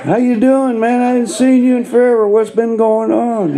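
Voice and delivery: high, overly friendly mock greeting; hammed-up buddy hello. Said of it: "he looks at me and goes".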